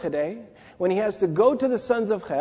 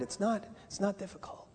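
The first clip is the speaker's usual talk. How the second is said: breathy whisper; whispered aside; 12 dB quieter than usual. Acted. Preacher whispering to the congregation that worldliness is easy. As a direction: whisper it like a secret.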